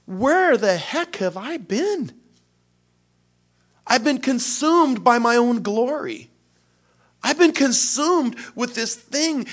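high, loud, slow and aghast; stunned self-reproach, drawn out; dismayed self-rebuke. Acted. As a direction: catch yourself in disbelief. Inner self-rebuke, realising obsession.

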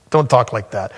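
normal talking voice

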